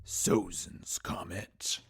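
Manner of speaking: slow, dramatic whisper; drawn-out, awed stage whisper; hushed title reveal. Acted. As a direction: whisper the title very slowly for effect.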